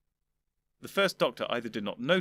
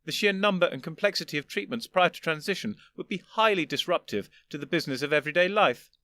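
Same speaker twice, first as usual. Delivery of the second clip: higher, louder condescending elder gentleman; an old gentleman patiently explaining; lofty old Doctor; 6 semitones higher, 4 dB louder than usual. Acted. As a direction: superior elder explaining to his younger self. First doctor lecturing.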